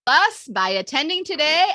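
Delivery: slow, high proclamation; emphatic closing line of a group pledge; mantra lead. Shown as a demonstration. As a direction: declare it grandly for the group to echo. Leading final mantra line.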